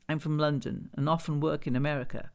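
ordinary delivery

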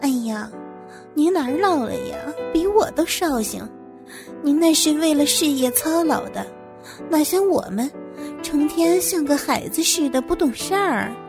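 smiling flattery. Acted. Employee assures boss of not being old, smiling flattery. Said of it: "我抬头笑着说".

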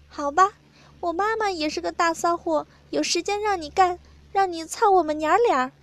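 high put-on partner's voice; acted consent. A narrator's reading. Narrator voices the partner giving in.

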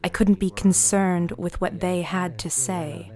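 ordinary talk, nothing marked

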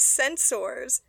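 "Censors" said as sen-SORS, with a full "sors"; stressed second syllable. Retold or imitated. Admiral giving a speech says "Censors" as sen-sors. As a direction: say "Censors" with a full OR vowel at the end. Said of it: "some people say censors".

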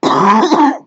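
loud harsh cough; coughing fit. Real, not acted. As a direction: hack hard before speaking. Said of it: "coughing attack".

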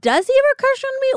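high fast, lightly voiced wide-eyed kid; mock naive girl. Friend imitating a naive girl, lightly voiced high and fast. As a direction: play it innocent and hopeful. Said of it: "And you're like".

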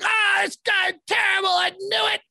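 mock Boston fan. Imitating Patriots fans yelling about Cam, mock Boston accent shout. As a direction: yell like an angry Boston fan in a bad Boston accent. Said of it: "that's not even a good Boston accent".

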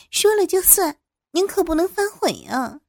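high, playful insistence; eager coquettish. A narrator's reading. Younger woman holding the boss to it, eager coquetry. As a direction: coyly hold him to his word. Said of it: "张娜急忙笑着说".

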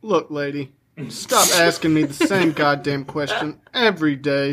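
low and tired; a low, fed-up magic mirror; weary mirror voice. Acted. Mirror answers the queen.

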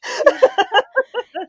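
high bursting laugh; real laugh. Real, not acted. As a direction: break into a loud high laugh. Laughing at own story.

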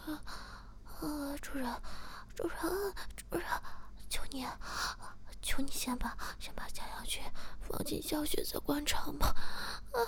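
high, breathy whispered begging mid-struggle; struggling. Acted. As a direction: plead while pulling against restraints. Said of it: "边挣扎边说".

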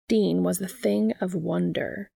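ordinary delivery, nothing marked

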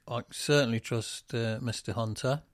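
usual speaking voice

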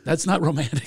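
normal talking voice

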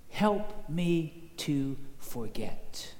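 slow, word-by-word answer; slow plea. Retold or imitated. Troubled student answers the preacher, slow plea. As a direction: draw out each word of the plea.

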